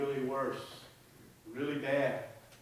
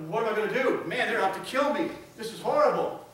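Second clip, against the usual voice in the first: higher and louder, a frantic little mouse; panicked mouse; 5 semitones higher, 6 dB louder than usual. Acted. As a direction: blurt it out in panic. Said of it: "the mouse panicked".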